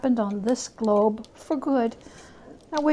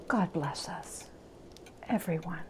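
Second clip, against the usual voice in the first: much softer, lower, tender close; hushed blessing; 4 semitones lower, 16 dB quieter than usual. A narrator's reading. Narrator ending the tale with Tiny Tim's blessing, hushed. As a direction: let it fade to a whisper of warmth.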